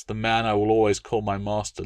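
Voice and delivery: usual speaking voice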